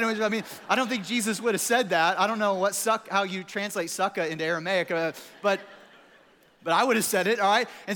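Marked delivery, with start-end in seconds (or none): none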